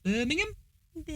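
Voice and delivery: high, soft Brummie try; Brummie accent attempt. Shown as a demonstration. Copying partner's Brummie demo, tentative high Brummie accent. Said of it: "What's Brummie again?".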